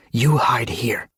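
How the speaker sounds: whispered instruction. Acted. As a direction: keep it hushed, hiding from danger. Hiding near a patrol.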